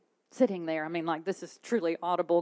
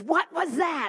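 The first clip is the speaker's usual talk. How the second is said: high startled question, imitating husband; mock-startled husband; 9 semitones higher, 4 dB louder than usual. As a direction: jolt awake and ask, pitched up. Husband startled awake, quoted.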